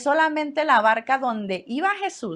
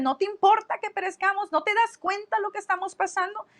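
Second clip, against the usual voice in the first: indignant disciples; 9 semitones higher than usual. Retold or imitated. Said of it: "llegan con actitud con él".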